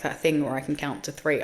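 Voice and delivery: ordinary talk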